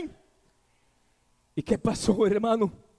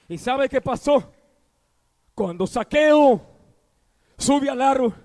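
ordinary talk, nothing marked

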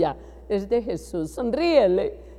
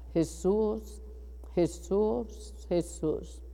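much softer, breathing the name Jesús; hushed prayer; 10 dB quieter, slower than usual. Real, not acted. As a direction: call on Jesús barely above a breath, as in prayer. Softly calling Jesus in prayer.

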